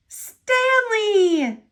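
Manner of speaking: high, loud little peep of relief. Acted. Trapped engine peeps at his rescuer. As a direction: squeak the name out, thrilled. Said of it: "he peeped".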